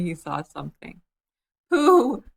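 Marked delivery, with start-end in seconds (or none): 1.7-2.2 loud, high alarmed cry of the name; sudden cry. A narrator's reading. Piglet spotting something climbing up Pooh, sudden cry. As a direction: yelp it out in a fright. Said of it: "he cried".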